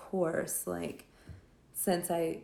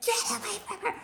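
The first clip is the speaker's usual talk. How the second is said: much higher squeaky yell with a laugh; mock heroic yell; 13 semitones higher, faster than usual. Hero yelling at the bully, mock high squeaky outburst, laughing. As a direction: play a panicky wannabe hero. Hero suddenly defends girl, mocked.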